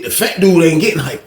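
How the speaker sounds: normal talking voice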